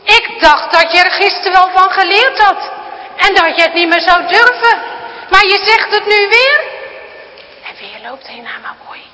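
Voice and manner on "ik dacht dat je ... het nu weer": loud, high, outraged scolding; furious grandpa. Acted. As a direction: rage at her for defying him. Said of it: "Woest springt opa opnieuw overeind".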